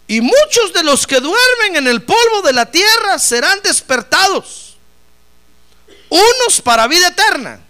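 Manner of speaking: high, loud declaiming of the verse; booming Bible reading; preached scripture reading. Real, not acted. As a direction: proclaim the prophecy to the pews. Reads scripture at full force.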